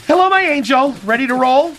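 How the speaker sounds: high, loud sugary hello; sweet greeting. Acted. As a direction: greet her like a darling.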